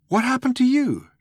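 very high, demanding question. A narrator's reading. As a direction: demand an answer, pitched right up. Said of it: "demanded Arthur".

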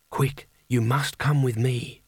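slow, lightly voiced alluring stranger; seductive woman's voice. A narrator's reading. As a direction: draw the urgent words out invitingly. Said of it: "a voice that could make "good morning" sound like an invitation".

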